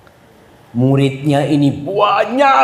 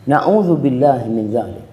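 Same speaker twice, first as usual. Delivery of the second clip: ordinary talk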